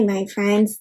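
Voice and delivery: ordinary talk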